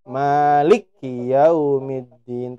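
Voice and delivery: "Maliki" slow with lam joined to kaf; slow "Maliki" run together; wrong joined lik. Shown as a demonstration. Teacher shows what may not be read. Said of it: "maka tidak boleh dibaca".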